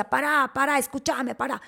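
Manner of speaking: high, loud repeated yelling; shouted demand. Retold or imitated. Wife retelling her fight with husband, shouting insistently. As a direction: yell over him to be heard. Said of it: "yo le estaba gritando".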